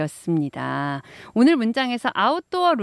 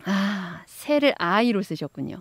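ordinary delivery

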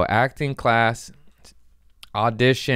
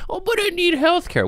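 much higher mocking voice of the excuse; mocking whiny voice. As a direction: squeak out the excuse in mock.

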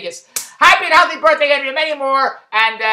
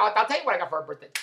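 normal talking voice

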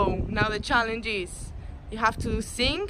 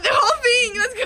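high, loud cheering shout; excited shout; 13 semitones higher, 6 dB louder than usual. Real, not acted. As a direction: cheer her on to finish. Cheering on the water drinker.

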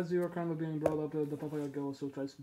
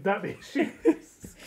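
laughing; 6 semitones higher, 8 dB louder, much slower than usual. Real, not acted. GM echoes laughing.

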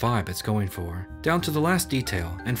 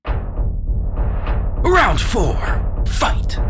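loud, much higher announcer shout; booming fighting game announcer; 11 semitones higher, 7 dB louder, much slower than usual. Acted. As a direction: hype the round like an arcade announcer. Fighting game style round call.

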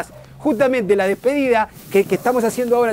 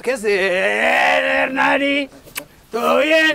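very slow, stretched hello; long-held comic call of greeting; drawn-out greeting; 3 semitones higher, much slower than usual. Acted. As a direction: hold the vowel on and on. Calls out a greeting.

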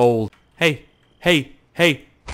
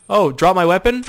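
ordinary talk

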